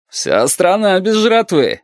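high, drawn-out mocking reply; smirking. A narrator's reading. Captain dismisses the plea about food, smirking. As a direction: brush it off with a smirk. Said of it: "ухмыльнулся капитан".

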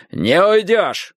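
slow, gruff bark. A narrator's reading. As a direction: bark as he lunges. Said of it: "гаркнул Страйк".

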